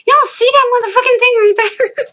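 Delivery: high, frantic disbelief, re-enacted; high-pitched hysterical retort; upset outburst. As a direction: act out yelling at grandmother in panic. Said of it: "I was so upset".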